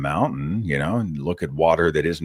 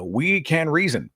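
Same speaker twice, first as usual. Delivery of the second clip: ordinary talk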